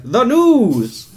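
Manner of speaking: high, loud hyped announcer; announcer shout. Shown as a demonstration. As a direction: belt it like a TV promo. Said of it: "and then you're gonna be like".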